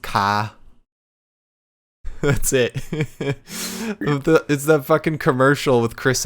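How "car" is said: "ka" with the r of "car" dropped; Boston pronunciation. Shown as a demonstration. Host showing their only Boston reference, "ka" without r. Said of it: "frame of reference I had with Boston".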